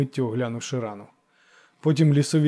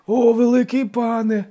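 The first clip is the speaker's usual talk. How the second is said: high, loud drawn-out lament; pleading, devoted cry; wailing; 10 semitones higher, 6 dB louder, slower than usual. A narrator's reading. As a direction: wail it out like a pleading village elder. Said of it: "заголосив староста".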